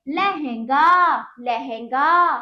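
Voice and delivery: लहंगा loud and stretched, long गा; emphatic, syllable-by-syllable लहंगा with nasal हं; model pronunciation. Shown as a demonstration. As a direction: draw लहंगा out for pupils to copy. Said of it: "तो बोलिए".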